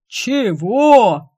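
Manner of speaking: loud, high outraged Chego; indignant yell. A narrator's reading. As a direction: shout it in outrage. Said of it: "возмущённо завопили оба".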